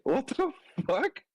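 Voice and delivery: high, stunned question; can't believe what you heard; shocked disbelief. Retold or imitated. Shocked at dog news.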